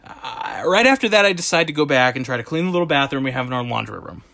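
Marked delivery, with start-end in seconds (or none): none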